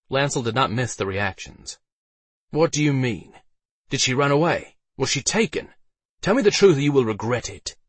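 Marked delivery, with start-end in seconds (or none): 2.5-7.8 higher, pressing knight's questions; urgent, demanding questioning; threatening interrogation. A narrator's reading. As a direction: press for answers, getting louder into a threat.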